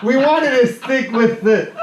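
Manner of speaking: high-pitched, laughing explanation; laughing while talking. Real, not acted. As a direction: struggle through the words while cracking up.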